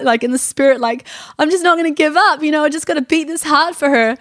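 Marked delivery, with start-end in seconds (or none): 1.4-4.2 higher, louder, defiant vow; fervent resolve. Retold or imitated. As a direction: push it out as a determined prayer. Refusing to give up praying.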